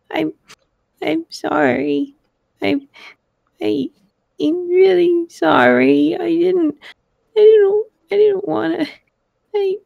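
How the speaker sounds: put-on high, halting little-girl apology; frightened child faltering between words; on the verge of crying. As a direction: stammer in a small voice, close to tears. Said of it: "and she started to cry".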